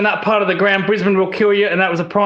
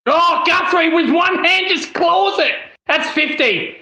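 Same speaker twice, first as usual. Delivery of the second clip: excited shout; 7 semitones higher than usual. Real, not acted. Excited at a one-handed mark.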